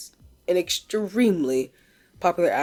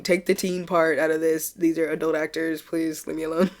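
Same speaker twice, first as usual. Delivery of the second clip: ordinary delivery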